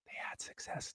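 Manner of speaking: hushed, breathy aside as if sharing a secret; stage whisper. Pretend. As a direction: whisper it behind your hand to the coordinator. Aside to the offensive coordinator.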